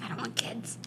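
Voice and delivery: whispering under the breath; whispered. Retold or imitated. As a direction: breathe it out voicelessly. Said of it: "I'm like".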